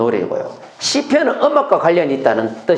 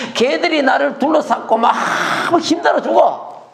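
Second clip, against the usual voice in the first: high, strained acted complaint; lamenting; 10 semitones higher than usual. Preacher as David surrounded by enemies, lamenting. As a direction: moan high as one hemmed in. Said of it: "막 탄식해요".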